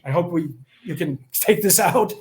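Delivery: ordinary delivery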